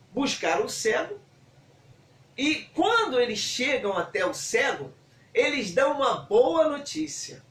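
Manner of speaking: usual speaking voice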